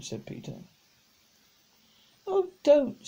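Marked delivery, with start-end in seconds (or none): 2.3-3.0 high, loud pleading cry. Acted. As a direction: cry out to stop the quarrel. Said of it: "cried Roberta".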